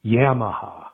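Yamaha with a short "a" as in yam; imitated odd pronunciation. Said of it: "an interesting way of pronouncing".

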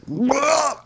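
high-pitched monster screech; gargoyle cry. Acted. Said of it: "she looks like a fucking gargoyle".

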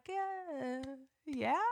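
imitated half-hearted cheer, soft and trailing; weak, hesitant mumble of agreement; unenthusiastic crowd. Audience reacting to an unknown name, unenthusiastic. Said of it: "crickets in that damn room".

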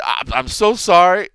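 breathless, high and loud apology; panting the words after a sprint; out of breath. Retold or imitated. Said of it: "uh, out of breath".

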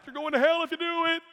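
high, loud hellfire warning; imitated old-time preacher. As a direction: thunder it like a preacher from the past. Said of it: "You hear a preacher from the past".

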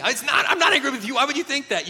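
loud, high, fast mock protest; hammed-up angry denial. Husband denying anger at his wife, hammed-up angry protest. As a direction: protest far too angrily, for comic effect. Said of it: "is like you're angry".

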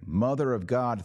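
ordinary talk

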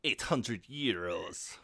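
put-on slow, overdone French accent; attempted French-international accent. Hotel receptionist quotes a guest the room price, comic French accent. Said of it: "That's too French for this accent".

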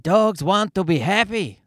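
a hint of a put-on accent, imitating the Dog Whisperer. Podcaster quoting the Dog Whisperer on dogs, light mock accent. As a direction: quickly slip into a mock Dog Whisperer accent, then drop it. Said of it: "I'm pretty sure that's not his accent".

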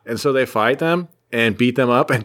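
normal talking voice

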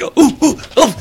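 imitating a wife tripping over her words; flustered stammer. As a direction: fumble for words, caught off guard. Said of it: "my wife starts stumbling on her words".

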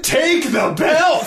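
high, slow wrestling challenge shout; mock promo yell. Drunk friend demanding the title belt, high mock promo yell. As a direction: stretch it out like a drunk cutting promos. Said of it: "yelling, and he had, like, he had one inflection".

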